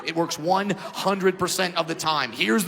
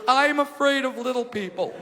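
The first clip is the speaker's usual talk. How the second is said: lightly voiced scared woman; a hint of a frightened woman; 4 semitones higher than usual. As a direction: plead a touch fearfully as her. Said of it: "And she goes".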